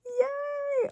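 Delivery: high squealed yay. Real, not acted. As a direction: squeal with delight. Squeals at pulling a card.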